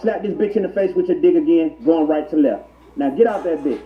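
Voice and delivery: normal talking voice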